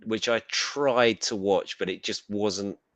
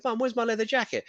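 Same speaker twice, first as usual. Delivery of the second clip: retold question, pitched well up; high-pitched mock appeal to mum; plaintive call to mum; 13 semitones higher than usual. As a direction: imitate a younger self calling for mum. Retells questioning mum about jacket.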